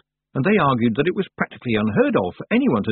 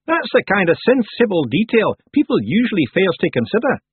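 loud, high Scots character voice; high, boisterous Feegle; approving Feegle; 10 semitones higher, 5 dB louder than usual. Acted. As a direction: approve heartily as a little Scot. Rob Anybody approves.

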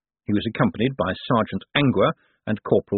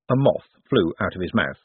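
usual speaking voice

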